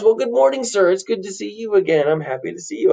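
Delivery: high, eager hello; cheery greeting. Acted. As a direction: greet a neighbour with bubbly delight. Landlord cheerfully greets a familiar face.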